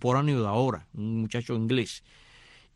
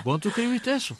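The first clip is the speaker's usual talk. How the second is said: quote; 9 semitones higher than usual. Retold or imitated.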